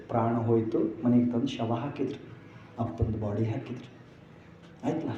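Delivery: soft, low, grave telling of a death; quiet mournful narration; hushed storytelling. A narrator's reading. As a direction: drop the voice to a hush for the sad part. Tells father's death softly.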